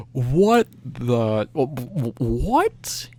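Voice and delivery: sputtering mock confusion, rising at the end; stammered bafflement. Performers back at changing spot, baffled stammer. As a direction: trip over it in disbelief. Retelling group's confusion after ceremony.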